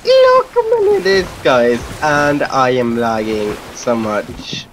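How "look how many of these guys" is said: excited squeal. Real, not acted. Amazed by crowd.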